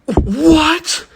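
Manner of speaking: very high, loud squealed "what"; comic shriek of bafflement; hammed-up shock. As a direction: yelp it in exaggerated disbelief. Retold stunned reaction to the claim.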